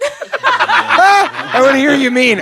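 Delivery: high, slow, laughing mockery of "mean"; mocking high voice. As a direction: squeal it back through laughter. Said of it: "mocking repeat".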